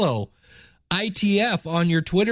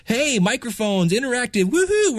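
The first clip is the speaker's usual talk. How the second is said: excited hype; 6 semitones higher than usual. Retold or imitated. Host hyping the audience about interactive microphones, excited cheer. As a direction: pitch soaring up to a cheering woohoo. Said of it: "he got us all excited".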